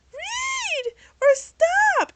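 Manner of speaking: high, loud, breaking scream of the name; desperate scream. Retold or imitated. Girlfriend screaming at Reed mid-fight, loud desperate cry. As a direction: shriek at him to stop. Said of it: "Darcy decides to scream out".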